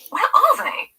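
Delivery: high, loud fretting cry; fretful. A narrator's reading. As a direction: panic over the lost keys. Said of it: "Trish sounds fretful".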